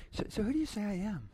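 throwaway version of the question; offhand quiet take. Shown as a demonstration. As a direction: toss it off lightly and quietly. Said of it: "He was like, was it just".

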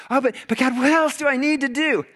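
fretful believer begging God; hammed-up anxious question; anxious pleading. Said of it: "anxious believer questioning God".

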